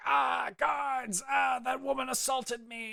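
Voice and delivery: very high, pained shouts for help; wounded shouting. Acted. Injured mayor calling his guards, pained shouting. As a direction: wail and yell in hammed-up agony. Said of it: "he's clutching his arm".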